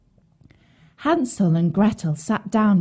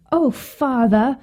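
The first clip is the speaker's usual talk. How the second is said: higher, drawn-out address; lightly voiced young Hansel, slow and higher; boy answering father; 5 semitones higher, 3 dB louder, slower than usual. A narrator's reading. Hansel answers his father's question, a hint of boyish pleading. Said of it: "said Hansel".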